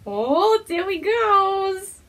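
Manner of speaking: high, loud and drawn out slowly; delighted. Real, not acted. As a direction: coo with joy at the pet. Delighted as pet moves.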